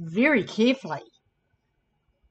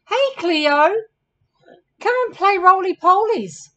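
higher, louder storybook invitation; keen camel character, pitched up; eager calling out; 9 semitones higher, 7 dB louder than usual. Acted. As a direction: call a friend over to play. Camel calls his friend over to play.

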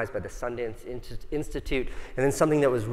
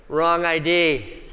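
slow, louder and higher, mock-innocent; deadpan excuse; 5 semitones higher, 5 dB louder, much slower than usual. Driver to the cop, a slow mock-innocent excuse. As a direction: drag it out like an obvious fib.

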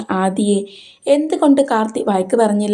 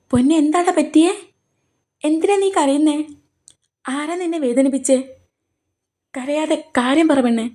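higher, coaxing and tender; tender concern; 6 semitones higher than usual. Acted. Karthi begs crying Aadi to tell him, tenderly. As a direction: soothe her like someone dear.

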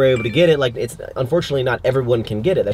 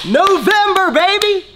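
loud, high victory yell; cheering the release month; hype shout; 6 dB louder, slower than usual. Real, not acted. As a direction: shout it out to celebrate.

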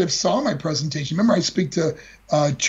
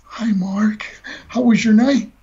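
mom waiting up; 4 semitones higher, 3 dB louder, slower than usual. Retold or imitated. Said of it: "She was like".

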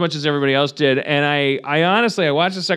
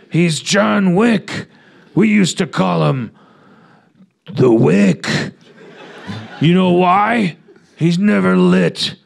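put-on old crime boss, loud and slow with a laugh; hammed-up mob father warning his son; mock gangster boss; 3 semitones higher, 4 dB louder, slower than usual. Mob father warns son about John Wick, loud hammed-up boss.

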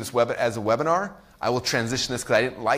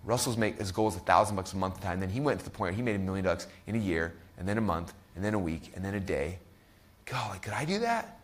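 hushed, softer daydreaming to yourself; softer, awed inner musing; dreamy inner voice; 5 semitones lower, 8 dB quieter than usual. Retold or imitated. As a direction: wonder quietly at the possibility. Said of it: "you visualize yourself".

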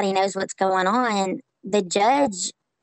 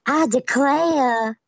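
slow, higher Southern belle drawl, hammed up; mock Scarlett O'Hara drawl; 5 semitones higher, much slower than usual. Mocking Hollywood's idea of how Southerners talk, in a slow Southern belle drawl. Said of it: "don't really talk like Scarlett O'Hara".

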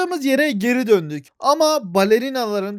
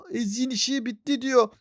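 ordinary talk

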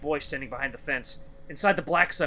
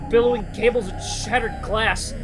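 higher, forceful dramatic storytelling; intense horror narration; 6 semitones higher, 5 dB louder than usual. A narrator's reading. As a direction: describe the monster with mounting horror.

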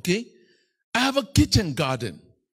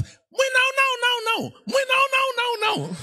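high yelping shout like a scared dog hiding under a car; barking dog voice. Acted. Preacher voicing the Shenzi dog barking from under the car, high yelping shout. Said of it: "it barks".

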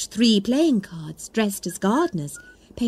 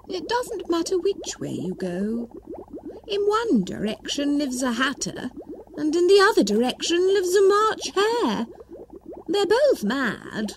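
1 Cheshire Cat; 8 semitones higher than usual. Acted.

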